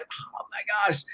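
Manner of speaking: awed hush. Retold or imitated. Son after catching a big trout, hushed awe. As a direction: let it out in hushed wonder. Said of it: "he went from crying to".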